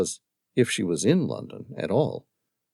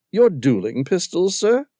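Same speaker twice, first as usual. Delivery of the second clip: British monotone drawl; 8 semitones higher, 5 dB louder than usual. Acted. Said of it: "in his British monotone drawl".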